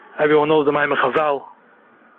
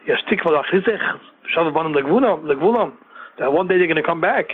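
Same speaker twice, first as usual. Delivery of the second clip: ordinary delivery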